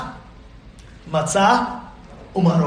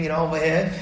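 normal talking voice

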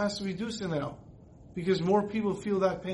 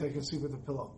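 a hushed, much softer closing line; softly, much quieter; 5 semitones lower, 8 dB quieter, faster than usual. Retold or imitated. Teenage daughter telling her parents why, much softer. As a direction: let it drop to a soft, much quieter confession.

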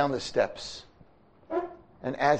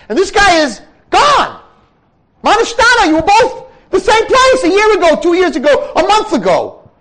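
very high, very loud fiery sermon; preaching at full force; 15 dB louder than usual. Acted. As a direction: pound the question into the room. Rabbi preaching to students.

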